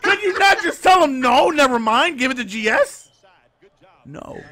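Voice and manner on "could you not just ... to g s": exasperated. Retold or imitated. Retold disbelief at friend's choice.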